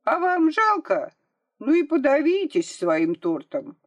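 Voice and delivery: high, loud taunting yell; defiant shout. A narrator's reading. Girl to the shopkeeper after running off, shouting defiantly. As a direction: yell back cheekily from a safe distance. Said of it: "крикнула".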